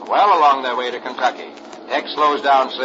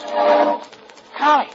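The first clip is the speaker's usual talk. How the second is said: put-on much higher, astonished boyish voice; amazed boy; 10 semitones higher than usual. Rusty finding the plane ticket, amazed and higher. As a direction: gasp it out like a kid who found a clue.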